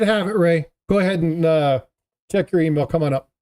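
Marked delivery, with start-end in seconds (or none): none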